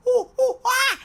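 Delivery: loud high monkey screams; monkey alarm hoots; screaming monkeys. Pretend. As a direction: shriek like monkeys in the trees. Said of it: "monkeys above would scream".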